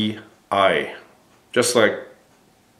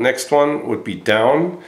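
ordinary talk

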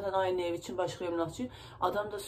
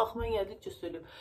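normal talking voice

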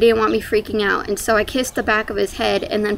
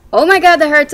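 louder, higher retold cry of pain; pained exclamation; 6 semitones higher, 8 dB louder, faster than usual. Woman retelling being cut open on the table, pained exclamation. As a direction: relive the sudden hurt, voice jumping up. Reliving pain during the operation.

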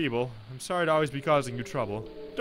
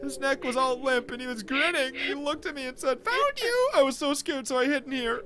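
put-on scared child Fluffy; 10 semitones higher than usual. Fluffy describing the crooked man to David, scared child voice. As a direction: tell it like a frightened child.

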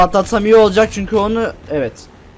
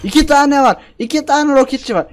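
loud, much higher panicked cry; panicked alarm; 8 semitones higher, 3 dB louder than usual. Real, not acted. Gamer spots two rocket soldiers, yelps in panic.

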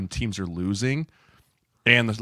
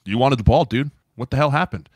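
louder, mildly indignant challenge; acted confrontation; 4 semitones higher, 6 dB louder than usual. Teammate calling out a player, louder acted confrontation.

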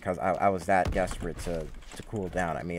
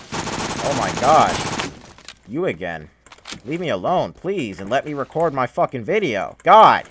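furious shout at the screen; angry yelling; 3 semitones higher, 8 dB louder than usual. Real, not acted. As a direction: yell in real frustration. Enemy keeps killing him.

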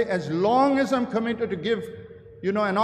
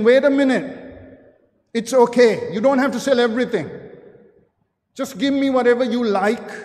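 pleading call; 3 semitones higher, 5 dB louder, faster than usual. Pretend. Imagined Jesus chasing the rich ruler.

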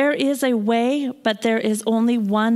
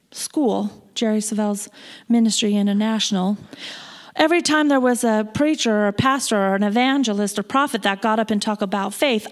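ordinary talk

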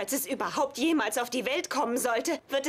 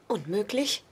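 whispering; 5 semitones lower than usual. Acted. Shocked whispered guess at pregnancy.

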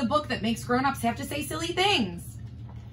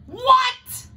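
high loud What; shocked shout; 13 semitones higher, 8 dB louder than usual. Real, not acted. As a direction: blurt it out in disbelief. Shocked at the book's words.

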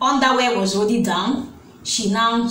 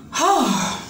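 appalled, falling exhaled "ho"; heavy sigh. Real, not acted. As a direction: let out a dismayed sigh. Appalled pause in the account.

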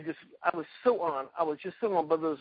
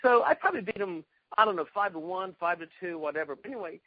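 ordinary talk

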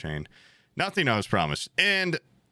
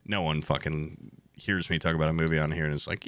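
normal talking voice

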